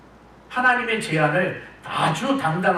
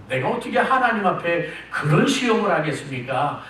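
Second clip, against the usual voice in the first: ordinary talk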